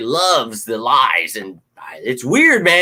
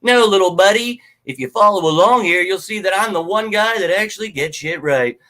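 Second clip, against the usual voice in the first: condescending retort; 5 semitones higher than usual. Retold or imitated. Answering a doubter online, higher mock-patronizing retort. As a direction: talk down to him like a kid. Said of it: "It's like".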